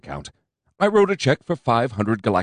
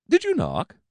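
sweetly; 14 semitones higher than usual. A narrator's reading. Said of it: "I asked sweetly".

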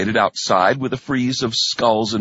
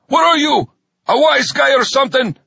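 angry sergeant; 13 semitones higher, 5 dB louder than usual. A narrator's reading. Sergeant glares at standing recruit.

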